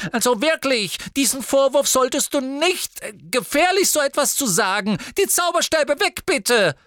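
very high, loud fretting witch; panicked Madame Malkin; flustered shopkeeper. A narrator's reading. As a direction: squeal out in alarm. Shopkeeper panics at drawn wands.

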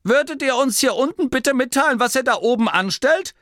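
high, irritated call from below; annoyed. A narrator's reading. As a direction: demand an explanation, exasperated. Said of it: "Dorcas verärgerte Stimme ertönte".

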